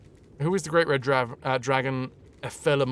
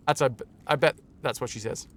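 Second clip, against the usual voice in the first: ordinary talk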